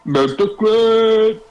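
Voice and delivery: loud, slow, high call. Acted. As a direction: call his name across the room. Calling out to the mayor.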